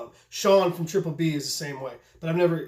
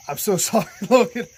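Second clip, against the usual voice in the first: laughing apology; 4 semitones higher, 5 dB louder than usual. Real, not acted. Apologizing for forgetting the credit.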